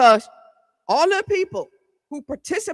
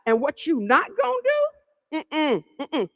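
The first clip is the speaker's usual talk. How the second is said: sassy retort; 4 semitones higher, slower than usual. Retold or imitated. Acted retort to officer.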